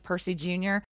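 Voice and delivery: ordinary talk, nothing marked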